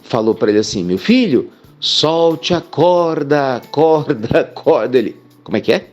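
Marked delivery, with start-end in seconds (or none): 0.8-5.0 very slow, with the last word echoing away; the voice tells the climber to let go, echoing. Acted. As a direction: drag out solte a corda and let corda echo. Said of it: "aquela voz que ele tava escutando".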